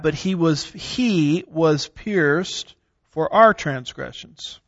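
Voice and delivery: pierced for our transgressions, our drawn out and stressed, very slow. Shown as a demonstration. Teacher stressing our while reading Isaiah, very slow. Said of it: "from the way I emphasize some of those".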